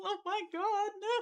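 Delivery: ordinary talk, nothing marked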